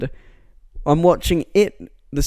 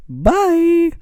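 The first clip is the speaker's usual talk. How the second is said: cheery vlogger bye. Pretend. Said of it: "I sound like one of those vloggers".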